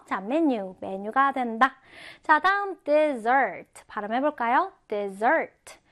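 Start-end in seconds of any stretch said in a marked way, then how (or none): 2.8-3.8 dessert with the stress on the back syllable, "-ssert"; stress on second syllable. Shown as a demonstration. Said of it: "강세가 요 뒤에 붙어요". 4.9-5.8 dessert with the stress on the back syllable, "-ssert"; stress on second syllable. Shown as a demonstration. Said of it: "강세가 요 뒤에 붙어요".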